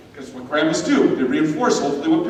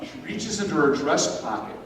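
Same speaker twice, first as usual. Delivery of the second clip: ordinary delivery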